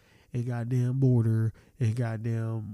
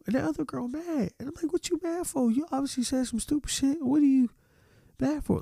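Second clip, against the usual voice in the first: much higher, squeaky disbelief; incredulous retelling; 13 semitones higher than usual. Retold or imitated. Retelling the Denny's fight to viewers, high incredulous voice. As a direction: pitch way up in disbelief.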